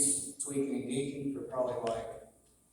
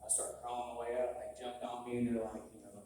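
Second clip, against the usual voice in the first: ordinary talk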